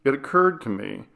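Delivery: ordinary delivery, nothing marked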